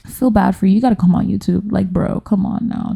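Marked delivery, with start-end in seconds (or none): none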